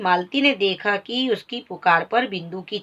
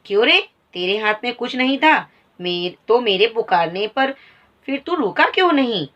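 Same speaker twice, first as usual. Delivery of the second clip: angry accusation; 3 semitones higher than usual. Acted. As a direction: call the boy a liar. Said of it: "मालती कहती है".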